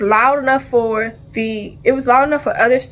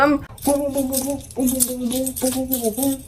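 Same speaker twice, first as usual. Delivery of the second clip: much softer punch sounds, run together; mock punch noises; 7 dB quieter than usual. On the classroom floor she fights back, miming the punches with soft booms. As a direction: mimic punches thrown from the floor, quietly.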